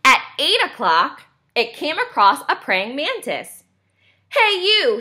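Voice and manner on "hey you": high-pitched grouchy call; cranky storybook bug; grouchy ladybug. A narrator's reading. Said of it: "said the grouchy ladybug".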